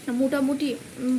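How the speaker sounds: ordinary talk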